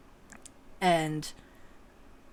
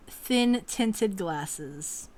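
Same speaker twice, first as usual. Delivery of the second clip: ordinary talk, nothing marked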